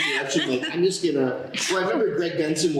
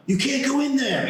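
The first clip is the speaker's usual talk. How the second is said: Chris Hemsworth; 12 semitones higher than usual. Acted. Trailer editors need one line, acted movie hero. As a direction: call out a dramatic film line. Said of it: "We need Chris Hemsworth to just say".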